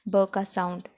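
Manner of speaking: usual speaking voice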